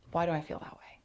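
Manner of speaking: breathy whispered question to yourself; hushed inner question. Acted. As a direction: ask it under your breath. Quiet self-questioning after failing.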